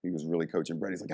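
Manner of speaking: usual speaking voice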